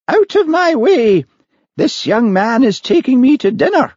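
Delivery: very high, loud dowager; imperious old lady. Acted. Lady Cranach brushing the manager aside, imperious old lady. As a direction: command it grandly from on high.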